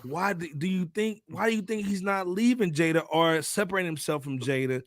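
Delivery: ordinary talk, nothing marked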